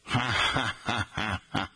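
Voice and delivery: staccato mock cackle, ha-ha-ha; put-on string of separate ha-has; imitated Lucille Ball laugh. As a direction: fake a comedienne's signature laugh. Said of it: "Trying to do her laugh".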